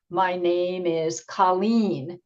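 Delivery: My name is Colleen, with Colleen stretched and hit hardest; slow, lean on the name Colleen; teacher modelling emphasis on Colleen; stress on Colleen. Shown as a demonstration. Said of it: "That's the word that gets the most emphasis".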